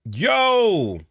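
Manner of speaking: high, drawn-out exclamation; astonished. Real, not acted. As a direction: stretch it out in disbelief. Stunned reaction.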